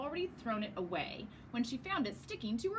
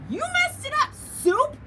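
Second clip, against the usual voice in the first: very high, loud tantrum yell; 13 dB louder than usual. Acted. Milk yells at Soup over her paper, very loud and high. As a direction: shriek it like a spoiled kid. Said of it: "she yelled".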